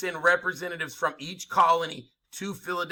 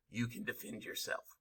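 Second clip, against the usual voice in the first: whispered; 5 semitones lower, 13 dB quieter than usual. Real, not acted. Emphatic aside.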